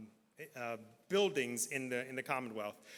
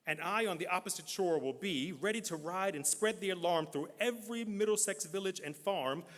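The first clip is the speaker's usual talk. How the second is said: measured dramatic reading with long pauses; poem recitation; 4 semitones higher than usual. Acted. Reader performing Longfellow's poem for the crowd, dramatic recitation. Reads poem aloud to audience.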